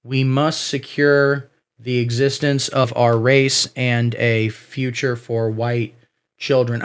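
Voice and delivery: low, slow, solemn recital; ominous slow read. Shown as a demonstration. Comedian slowly reciting the slogan again in a low ominous voice. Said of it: "which are".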